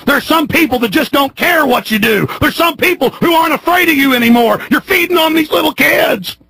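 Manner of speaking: high-pitched shouted accusation; furious tirade. Real, not acted. Host accusing officials of preying on kids, furious tirade.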